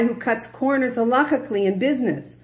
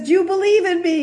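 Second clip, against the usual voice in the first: high, loud, fast question from God; lightly voiced God pressing him; God quizzes David on his faith; God asking; 10 semitones higher, 5 dB louder, faster than usual. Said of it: "He says".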